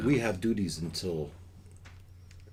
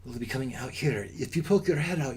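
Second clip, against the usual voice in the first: usual speaking voice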